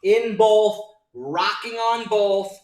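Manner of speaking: loud, high, drawn-out victory calls; excited celebration. Real, not acted. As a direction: shout the winning numbers slowly to the room. Trader celebrates new highs live.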